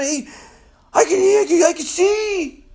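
high, excited cry of disbelief; pumped, tripping out. Acted. Healed blind man banging on the neighbors' doors, pumped and tripping out. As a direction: burst out with overjoyed amazement. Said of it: "this guy is pumped. He's tripping out".

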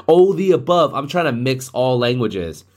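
ordinary talk, nothing marked

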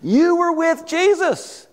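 high, drawn-out accusation; acted pointed accusation; accusing bystander. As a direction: slowly call him out, pitched high. Acted third accusation of Peter.

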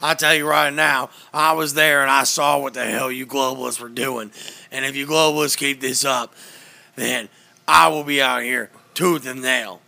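loud, ranting talk-radio host; Alex Jones impression. Telling a friend about Alex Jones confronting a governor, a loud ranting impression. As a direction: bellow it like a conspiracy radio host. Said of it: "that was a good Alex".

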